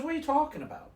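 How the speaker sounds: high, exasperated mock bewilderment; imitating bafflement. Companion hearing about the wrong actress, imitated bafflement. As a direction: mimic a thoroughly confused companion, pitch way up.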